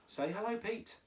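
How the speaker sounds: ordinary talk, nothing marked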